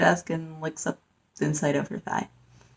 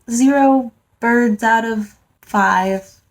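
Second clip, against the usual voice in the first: slow deadpan verdict; 3 semitones higher, much slower than usual. Real, not acted. Reviewer giving the book her final score, drawn out. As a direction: drag it out, very slow and dry.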